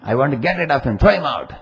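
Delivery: high, loud outburst, acting out an angry guru; Babaji shouting. Guru orders followers to remove a visitor, shouting. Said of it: "And he shouted".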